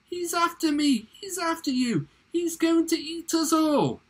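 hammed-up high, loud panic; theatrical high-pitched wail of alarm; shrieking dentist. A narrator's reading. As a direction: shriek in mock terror. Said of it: "the dentist shrieked".

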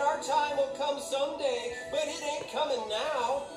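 high-pitched plucky beaver speech; storybook pep talk from a little beaver; small beaver rallying. A narrator's reading.

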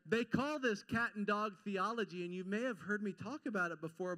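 usual speaking voice

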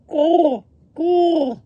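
two high, loud caws; crow cawing. A narrator's reading. Crow sitting on the scarecrow, loud high caws. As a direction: screech like a crow. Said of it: "The crow sitting on top".

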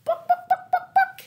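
very high clucks; hen clucking. Acted. Mama Chicken clucks.

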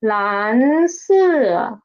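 蓝色 stretched out, 蓝 climbing and 色 falling from the top; rise then fall. Shown as a demonstration. Teacher shows students the contour of 蓝色, stretched rise and fall. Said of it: "It's like this".

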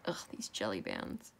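frustrated whisper under breath; whispered grumble. Real, not acted. Annoyed aside to herself.

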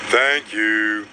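low, put-on character thanks; tuba player voice. A narrator's reading. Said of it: "said the tuba player".

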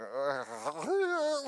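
drawn-out made-up vocal noise; silly mouth noise. Retold or imitated. Husband faking the mystery noise for wife, silly mouth sound. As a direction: fake a strange noise with the mouth. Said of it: "every possible noise I could with my mouth".

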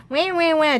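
high, loud mock whining; mock whine. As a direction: whine like a baby. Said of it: "There's my whine".

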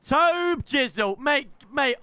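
high, slow, drawn-out hype call; high-pitched excited hello to a famous teammate; excited greeting. Real, not acted. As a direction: stretch the name out like a fanboy.

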